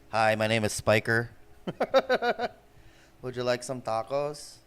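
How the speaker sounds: joke persona. Acted. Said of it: "this is me being Mexican".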